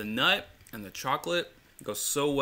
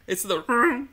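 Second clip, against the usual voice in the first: Chewbacca howl; 12 semitones higher, 5 dB louder than usual. Acted. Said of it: "It sounds like a dog crying".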